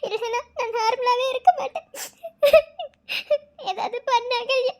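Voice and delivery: tearful plea broken by sobs; sobbing. Acted. Begging Akalya to let her see Kavin, sobbing. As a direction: cry and beg for help. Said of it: "நீ அழுகிறத".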